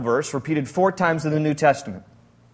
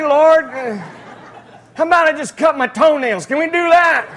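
higher, laughing, pleading disbelief; incredulous Abram; 7 semitones higher than usual. Acted. Abram bargaining with God over circumcision, high laughing disbelief. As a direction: beg God for another way, half laughing. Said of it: "a little bit of dialogue that goes unrecorded".

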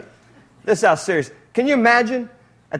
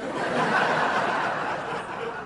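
quiet breathy laugh; 10 dB quieter than usual. Real, not acted. Preacher laughs at the command.

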